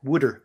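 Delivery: Wooder, said like WOOD-er; Wooder, Philadelphia style; Philly water. Shown as a demonstration. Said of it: "if they say water".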